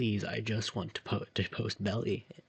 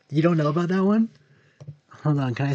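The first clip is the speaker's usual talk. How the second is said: excited; 7 semitones higher, 13 dB louder than usual. Real, not acted. Excited question to chat.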